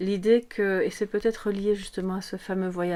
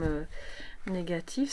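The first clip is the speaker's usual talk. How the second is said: ordinary talk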